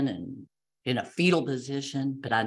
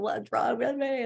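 anxious plea; 9 semitones higher than usual. Retold or imitated. Asking staff what's wrong.

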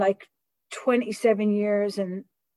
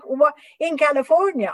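incredulous; 6 semitones higher, 3 dB louder than usual. Retold or imitated. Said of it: "I'm like".

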